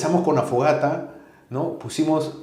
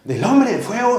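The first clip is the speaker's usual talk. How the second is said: high rising question, amazed; astonished child; 10 semitones higher, 5 dB louder than usual. Retold or imitated. Son hearing of the fire man, high and amazed. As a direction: ask it wide-eyed.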